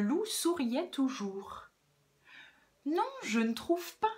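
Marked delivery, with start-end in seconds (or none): 2.8-4.1 high, sugary denial; honeyed and gentle, pitched up; extra-kind voice. Acted. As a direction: disagree as sweetly as you can. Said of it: "répondit-il d'une voix encore plus gentille".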